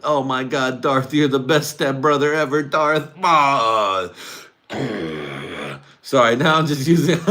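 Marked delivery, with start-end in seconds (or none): 0.0-4.1 high, loud hammed-up excitement; mock ecstatic. Luke to his stepbrother Darth, mock ecstatic cries. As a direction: gush louder and louder to a cry. Acts Luke gushing, climaxing.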